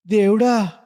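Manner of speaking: high, loud cry of distress; a man calling on God while his friend dies; anguished cry. Acted. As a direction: cry out to God in helpless despair. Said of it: "అంటూ కాతరంగా".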